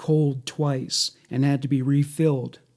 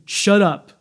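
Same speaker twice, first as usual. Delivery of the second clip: higher, louder sharp order; angry command; 6 semitones higher, 5 dB louder than usual. A narrator's reading. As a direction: firmly silence them, as read by a narrator. Reads captor's order from transcript.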